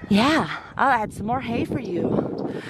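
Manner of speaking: slow, gentle pet voice; soothing. Real, not acted.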